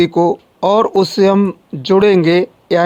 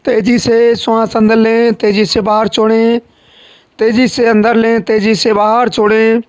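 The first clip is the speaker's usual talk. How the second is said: raised, insistent yoga instructor voice; breathing exercise coaching; 4 semitones higher than usual. Real, not acted. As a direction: drive the breathing on at a higher pitch.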